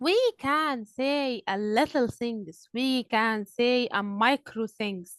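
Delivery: slow, drawn-out model answer; slow and clear. Real, not acted. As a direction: lay out each word slowly for learners. Teacher models answer for class.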